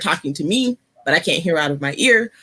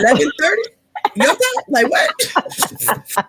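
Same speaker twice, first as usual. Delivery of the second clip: high-pitched incredulous questions; amused disbelief; 10 semitones higher, slower than usual. Real, not acted. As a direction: question it high, breaking into a laugh. Teasing guest about being up early.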